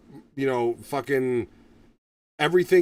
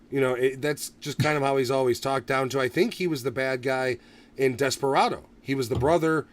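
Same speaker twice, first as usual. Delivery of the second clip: ordinary delivery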